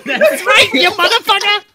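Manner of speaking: very high mobster squeal; Joe Pesci. Acted. Mouthy little gangster cursing, high squawking mock threat. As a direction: taunt in a loud squeaky gangster voice. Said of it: "sound like Joe Pesci".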